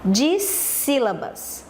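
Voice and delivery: "Dissílabas" very slowly, stretching the first syllables; slow, teacherly "Dissílabas"; slow term announcement. Shown as a demonstration. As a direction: draw out "Dissílabas" so pupils catch the term. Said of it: "Como é o nome?".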